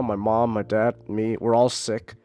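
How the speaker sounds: normal talking voice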